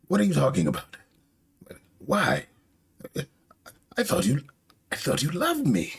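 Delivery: high, faltering acted protest; hurt disbelief. Orochi learns Komurosaki betrayed him, hurt high-pitched disbelief.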